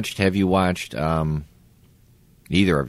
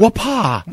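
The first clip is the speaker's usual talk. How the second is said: high yelped whip crack; whip crack shout; 3 dB louder than usual. Retold or imitated. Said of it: "You can do the Friends, uh, whip".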